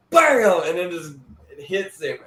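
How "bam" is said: loud, higher "Bam!" for the hit; mock punch noise. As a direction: punch it out like a sound effect. Retelling the punch.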